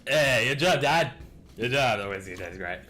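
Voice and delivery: big playful shout to Dad, settling by the end; hammed-up congratulating Dad. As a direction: call out a mock hearty compliment. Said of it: "it's just like".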